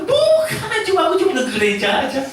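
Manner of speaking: very high, then rushed and fast; a hammed-up flustered excuse; sheepish denial. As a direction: squirm out of it quickly. Believer denies faith sheepishly.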